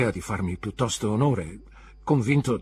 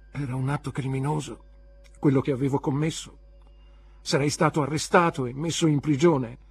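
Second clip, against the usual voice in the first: building higher and louder to panic; growing dread; 6 semitones higher, 6 dB louder than usual. A narrator's reading. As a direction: let the fear mount line by line. Boy's fear grows. (terrore crescente, da ansia a panico più forte)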